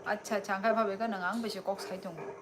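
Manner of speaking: ordinary talk, nothing marked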